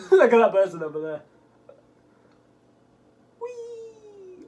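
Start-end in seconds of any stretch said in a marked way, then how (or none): 3.4-4.4 high, soft, drawn-out whee; playful whee. Pretend. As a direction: squeal it small and high. Playful noise as ball flies.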